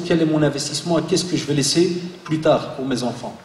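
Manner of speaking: ordinary talk, nothing marked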